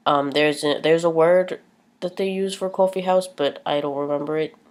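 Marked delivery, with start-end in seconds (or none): none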